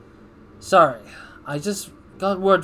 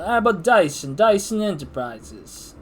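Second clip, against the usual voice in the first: trying a voice; 5 semitones higher, 4 dB louder than usual. Acted. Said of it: "What do I wanna give Dyson, uh, voice?".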